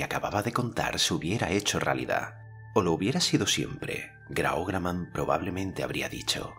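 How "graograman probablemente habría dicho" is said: ordinary delivery, nothing marked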